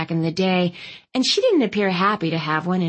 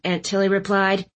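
plain reading voice

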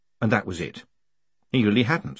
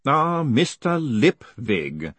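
slow, drawn-out, raised voice; distant voice; 4 semitones higher, much slower than usual. A narrator's reading. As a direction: drift in slowly as if from afar. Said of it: "said a distant voice, getting closer".

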